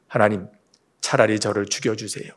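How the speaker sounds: low, soft retold plea of defeat; understated despair, pitched low; mock despairing plea. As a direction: plead quietly, as a worn-out prophet. Moses begs God for death.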